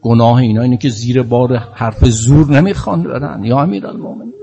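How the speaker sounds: loud, rising impassioned lament; preaching at full force. Real, not acted. Preacher pleading to the Imam for persecuted Shia. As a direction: build to a crying peak of fervor.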